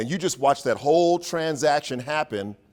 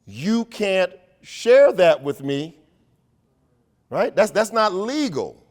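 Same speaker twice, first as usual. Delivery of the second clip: higher, louder, slowed objection; an educator silently alarmed; mock scandalized thought; inner protest; 5 semitones higher, 5 dB louder than usual. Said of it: "I'm thinking".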